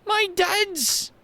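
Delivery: put-on high, defiant young girl; girl rejecting the mayor as her father, high and defiant. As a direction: refuse him flatly as the girl, high.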